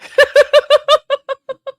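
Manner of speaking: helpless high-pitched laughter; long high squealing laugh. Real, not acted. Streamer laughing helplessly at the hat. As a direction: let the laugh run away.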